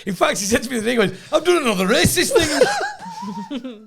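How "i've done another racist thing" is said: high, slow words breaking into laughter; laughing quote. Retold or imitated. Quoting his mate's confession to co-host, laughing. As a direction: quote your mate while cracking up. Quoting friend while cracking up.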